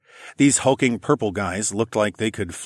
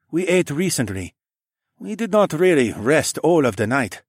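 put-on higher, lightly accented man; Sun He voice; 6 semitones higher than usual. A narrator's reading. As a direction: give him a polite, formal lilt. Sun He character voice.

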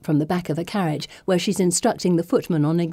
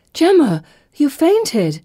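much higher, louder worried cry; alarmed friend; 9 semitones higher, 6 dB louder, slower than usual. Acted. Friend cries out to the fainting girl, high alarmed worried voice. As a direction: shake her out of the faint with worry.

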